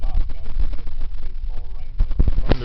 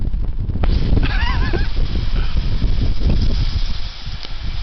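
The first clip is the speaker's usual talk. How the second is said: laugh in the wind. Real, not acted.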